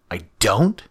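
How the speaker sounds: loud, rising challenge; challenging. Acted. Scratch challenging Damien, loud and rising. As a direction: dare him to say it again. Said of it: "more challenge than actual question".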